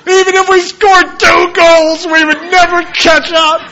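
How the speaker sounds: put-on high, loud wailing boy's lament; mock crying kid. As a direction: wail it like a devastated child. Son crying about the score.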